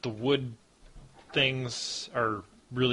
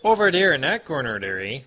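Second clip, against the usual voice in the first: bad Yooper accent; 2 semitones higher, 6 dB louder, faster than usual. Game master teasing the player about a corner, mock Yooper accent. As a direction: lay on a badly faked Yooper accent. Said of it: "That's the worst accent you can do".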